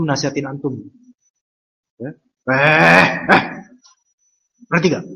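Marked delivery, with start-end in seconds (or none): 2.5-5.0 louder mock scolding, Betawi-style; Betawi father scolding. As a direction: imitate a Betawi father telling off his son. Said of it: "orang Betawi ngomel".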